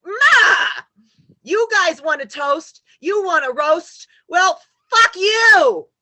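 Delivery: scornful toast-and-roast tirade; mocking angry yell. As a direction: spit out the final fuck you. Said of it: "Yeah, like".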